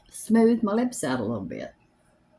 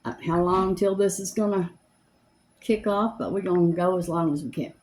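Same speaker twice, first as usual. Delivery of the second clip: normal talking voice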